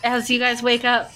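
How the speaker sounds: ordinary talk, nothing marked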